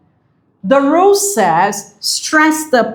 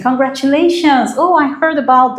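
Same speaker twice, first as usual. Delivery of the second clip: higher-pitched congratulations, easing back down; congratulating someone; 5 semitones higher than usual. Acted. Telling someone you heard their good news, congratulatory. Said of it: "you tell that person".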